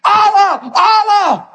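high-pitched cry of mock panic; imagined panicked cry. Preacher imagining terrified kids giving in, mock-panicked cry. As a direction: cry it out like a freaked-out kid. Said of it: "He should be screaming".

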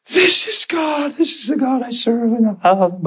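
high, stretched-out mock cry; hammed-up weepy devotion; tearful declaration. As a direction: cry it out overcome, pitch falling as you go. Retells tearful declaration to wife.

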